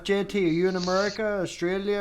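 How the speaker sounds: ordinary talk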